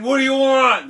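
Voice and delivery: high, loud yell back; annoyed holler. Acted. Jack burdened with the donkey answering the king, loud holler.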